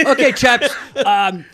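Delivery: hammed-up Scottish coach addressing the players; attempted Scottish accent. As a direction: imitate a chap-ish coach with a Scottish accent. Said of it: "it's actually a Scottish accent".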